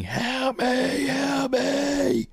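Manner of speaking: high, far-off wailing plea; screaming for help. Retold or imitated. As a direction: wail it like a lost soul. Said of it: "you hear people just screaming".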